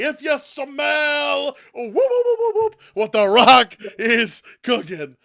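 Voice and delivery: high, loud whooping Stooges cry; mock Rock catchphrase. The Rock as Curly the Stooge, whooping loud and high. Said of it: "out comes Curly".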